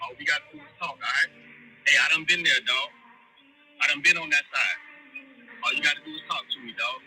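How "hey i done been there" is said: usual speaking voice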